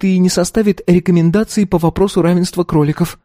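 put-on high, light girlish voice; young girl voice. A narrator's reading. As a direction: voice the earnest teenage daughter. Narrator voices the daughter.

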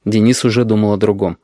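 usual speaking voice

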